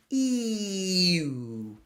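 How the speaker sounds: "E-oo" whispered, ee to oo; whispered glide. Shown as a demonstration. Coach whispers the ee-oo glide for students. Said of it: "very slowly move your lips forward".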